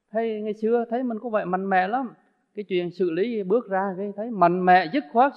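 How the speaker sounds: usual speaking voice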